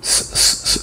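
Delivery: স as a bare voiceless s, repeated; স said without any vowel; isolated s sound. Shown as a demonstration. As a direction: give স as separate soft s sounds. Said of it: "this one is sound".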